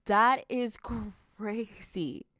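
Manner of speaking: slow, drawn-out loud disbelief; stunned disbelief. Real, not acted. Reading boss's text to viewers, stunned and drawn out. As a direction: let it sink in, stretched and loud.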